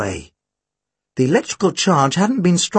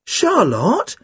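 higher-pitched protest of her name; 6 semitones higher than usual. A narrator's reading. Tim objecting to Charlotte, protesting. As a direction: plead in protest, pitch lifted. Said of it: "Tim protested".

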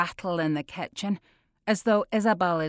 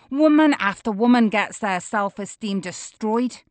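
usual speaking voice

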